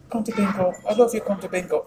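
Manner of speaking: high mock mum, coaxing; imitating mum. Son mimicking his mum coaxing him to bingo, high-pitched. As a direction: coo it like his doting mum. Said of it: "she goes".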